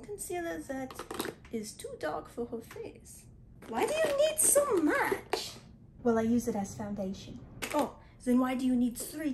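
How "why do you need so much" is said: incredulous. Acted. Disbelief at makeup bag.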